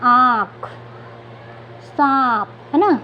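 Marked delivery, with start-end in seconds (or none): 0.0-0.7 आँख drawn out with a nasal aa; आँख slowly, aa through nose and mouth; nasal pronunciation demo. Shown as a demonstration. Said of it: "अब इसका उच्चारण करते हैं हम". 1.8-2.5 सांप drawn out with a nasal aa; सांप slowly, aa through nose and mouth; nasal pronunciation demo. Shown as a demonstration. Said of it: "अब इसका उच्चारण करते हैं हम".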